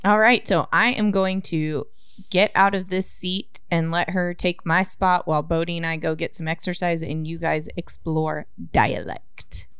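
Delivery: usual speaking voice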